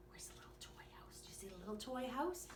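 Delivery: put-on quick hushed whisper to a child. Asking the child a question in a quick whisper.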